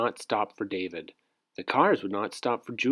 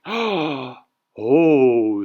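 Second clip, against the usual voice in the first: delighted; 3 semitones higher, 7 dB louder than usual. Acted. Gasps at cheeseburgers.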